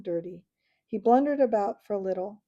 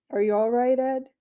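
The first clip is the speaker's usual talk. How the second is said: much louder shout across the dark; calling out; 4 semitones higher, 8 dB louder than usual. Acted. Boy calls to his brother in the dark, loud. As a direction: call out to find him.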